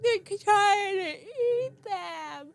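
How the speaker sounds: put-on very slow, high, wailing child; sobbing toddler. Little brother pointing at the hawks, slow high wail. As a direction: drag it out through tears. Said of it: "And he's like".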